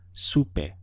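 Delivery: supe said su-pe, ending on a clear e; slow supe with an e sound at the end; careful model word; two syllables. Shown as a demonstration. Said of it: "just two syllables".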